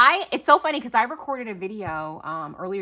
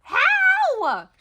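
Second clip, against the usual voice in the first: astonished squeal; 6 dB louder than usual. Real, not acted. Stunned by planner gift.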